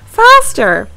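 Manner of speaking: loud high urgent call; urgent shout. A narrator's reading. Sarah fleeing the cat with Sam, loud urgent call. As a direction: hurry him along. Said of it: "Sarah squeaked".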